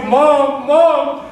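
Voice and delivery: imitated hysterical calling; calling for Mom. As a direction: call out desperately for your mother. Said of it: "she was calling for you".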